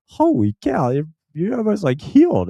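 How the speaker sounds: high-pitched astonishment; imitated amazed doctor. Surgeon seeing the finger almost healed, high and amazed. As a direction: mimic a surgeon's happy disbelief.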